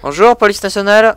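loud, firm police announcement; police officer calling out. Acted.

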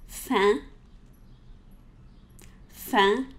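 fin with nasal E vowel, no n; French fin. Shown as a demonstration. Teacher modeling fin, nasal E. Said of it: "So say this with me".

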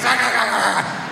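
long high frantic dog cries; imitated dog howls. Blue-eyed dogs pulled from cages, imitated wild howling. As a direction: mimic a pack of dogs going wild. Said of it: "all these dogs being pulled out".

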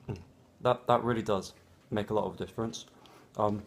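chewing while talking; speaking between bites; mouth full of toast. Real, not acted. Seen 1: bit toast at -5, jaw and cheeks still chewing until ~4.5.

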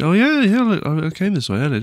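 high, quick excited yelp; suddenly realizing, quickly; excited recognition. Real, not acted. Recognizing the cave path.

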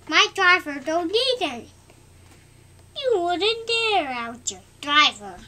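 loud, fast jeering engine voice; taunting engine. Acted. Cocky engine taunts Thomas, loud jeering.

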